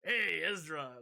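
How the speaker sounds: loud, high put-on Hondo accent, greeting Ezra; a brash pirate-like hail; Hondo's accent. Imitating Hondo greeting Ezra with a loud, high accent. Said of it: "he has, like, an accent".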